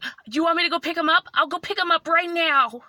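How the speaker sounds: high, loud, hurried offer; upset, high-pitched eagerness to make amends; tearful pleading. Retold or imitated. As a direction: plead urgently as if tearing up. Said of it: "tears are welling up in my eyes".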